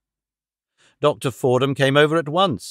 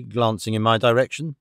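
ordinary delivery

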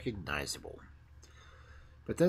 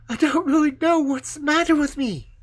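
much higher, louder yearning tree voice; longing young fir tree; 10 dB louder than usual. Acted. The fir tree longs to be taken away, high and yearning. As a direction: pine for it like an impatient child.

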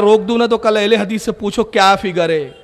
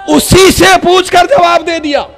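much higher, loud climax; triumphant shout; 8 semitones higher, 6 dB louder than usual. Acted. Preacher shouting the triumphant climax of the story. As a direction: shout the punchline to the crowd.

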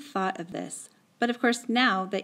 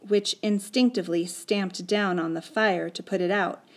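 ordinary delivery, nothing marked

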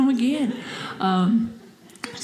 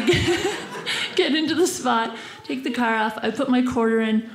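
usual speaking voice

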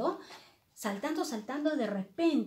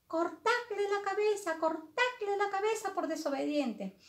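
high-pitched royal command; angry queen; 9 semitones higher than usual. Acted. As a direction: pass sentence as a cross storybook queen, pitched up. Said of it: "la reina le dijo".

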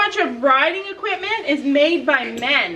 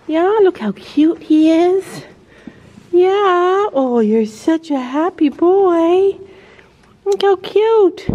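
pet talk; 5 semitones higher, 5 dB louder, slower than usual. Real, not acted. Owner gushes over the cat in high baby talk. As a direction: gush over the happy cat, slow and high.

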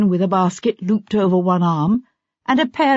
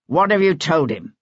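demanding. A narrator's reading. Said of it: "he demanded".

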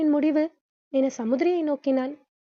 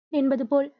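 ordinary delivery

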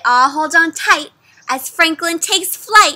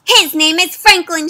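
louder defiant call; shout; 4 semitones higher, 7 dB louder than usual. Acted. Luna defending Franklin to the villagers, shouting. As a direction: stand up for your friend out loud. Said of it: "shouts Luna".

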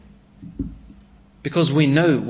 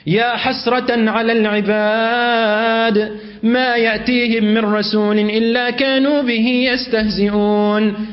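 Quran recitation; 5 semitones higher than usual. Acted. Preacher recites the verse lamenting the people, chanted. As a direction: intone it as recitation.

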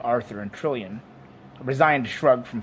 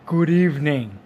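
louder, drawn-up bovine waiter; louder creature voice, eager to serve; genial dairy animal; 3 semitones higher, 6 dB louder than usual. Acted. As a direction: greet them as an obliging beast.

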